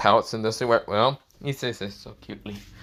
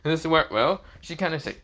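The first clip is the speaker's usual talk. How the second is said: ordinary delivery, nothing marked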